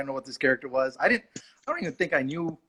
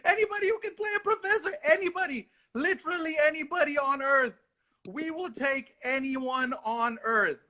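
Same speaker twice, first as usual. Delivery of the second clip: desperate plea; 12 semitones higher than usual. Retold or imitated. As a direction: beg for any actor at all, hammed up.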